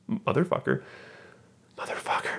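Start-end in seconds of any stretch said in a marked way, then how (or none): 1.8-2.4 a breathy whispered swear instead of a scream. Retold or imitated. Retelling the heroine's alley scream, whispered. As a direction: hush it right down to a whisper, as if mock screaming under the breath.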